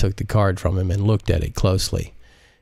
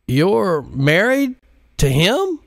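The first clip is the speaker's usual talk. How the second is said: much higher, slow, stunned "married"; shocked surprise; 11 semitones higher, slower than usual. Acted. Pilot shocked to learn Modise is married, high and stunned. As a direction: ask as if you can't believe it. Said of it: "with honest surprise and shock".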